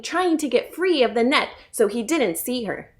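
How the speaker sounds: plain reading voice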